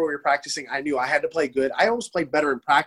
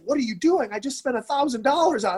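much higher-pitched mock indignation; retold dad's scolding; 9 semitones higher than usual. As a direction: imitate an exasperated dad, pitched up.

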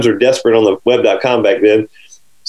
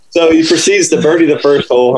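usual speaking voice

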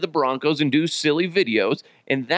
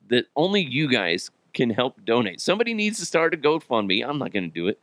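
ordinary talk, nothing marked

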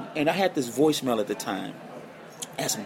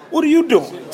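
high and loud, caught off guard; startled protest; 11 semitones higher, 7 dB louder than usual. Retold or imitated. Dying mentor stops student from paying, high loud disbelief. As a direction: stop him in his tracks with disbelief.

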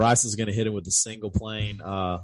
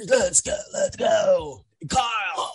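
high, strained full-throated holler; hyped-up yelling; 11 semitones higher, 5 dB louder than usual. Acted. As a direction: yell it like a raging bro before a fight. Acting out Bryson blacked out, raging.

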